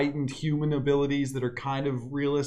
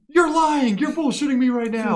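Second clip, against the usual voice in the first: high, loud disbelieving outburst; disbelieving accusation; 9 semitones higher, 4 dB louder than usual. Retold or imitated. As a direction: call out the liar in shock. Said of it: "the dude was like".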